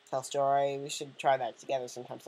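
normal talking voice

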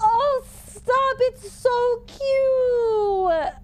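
high, very slow cooing squeal; squealing at cute. Real, not acted. Streamer overwhelmed by a cute toy, high drawn-out squeal. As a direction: stretch it out, overwhelmed by cuteness.

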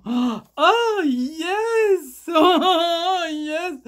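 high, loud, laughing cries of joy; thrilled squeal. Real, not acted. Vlogger finding the DVD she wanted, laughing squeal of joy. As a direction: squeal with delight at the find.

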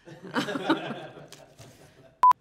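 long loud laugh. Real, not acted. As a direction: break up laughing. Laughing at illegal question.

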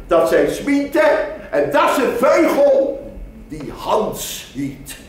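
high, loud market patter; pushy bird seller. Acted. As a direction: talk the customer into buying birds. Bird seller pushing wares. (opdringerige vogelverkoper)

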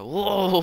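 long « oh » fort et plus aigu; cri de censure. Pretend. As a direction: couvre la scène d'un grand « oh » feint. Censure des ébats des poules. (grand cri faussement choqué)

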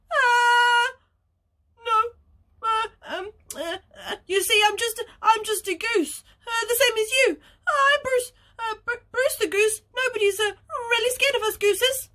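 high, stammering goose voice; nervous goose. Acted.

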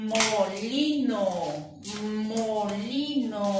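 Molino drawn out slowly, syllable by syllable; slow sounding out. Shown as a demonstration. Teacher sounding out a word for her pupils, slowly. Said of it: "Con la sílaba mo".

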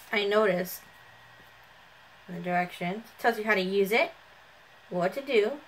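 stiff mask limiting mouth; through a face mask. Real, not acted. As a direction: lips barely moving under mask. Seen 2: Wet sheet mask covers face and mouth area while talking.

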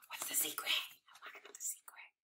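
hushed, pleading whisper; eager whisper. Acted. Voicing a listener who wants to know the secret, whispering. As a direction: beg in a whisper to be told.

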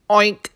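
quick piggy grunt-word; hog's oink. A narrator's reading. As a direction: oink like a hog. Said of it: "said the hog".

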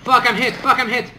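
loud high yell of being hit; man down shout. Acted. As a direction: cry out as if just shot. Soldier shot, crying out.

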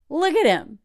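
ordinary delivery

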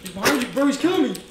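very high, very loud panicked yelling; excited screaming. Real, not acted. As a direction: scream for help while laughing. Panicked gaming fight, mob attack.

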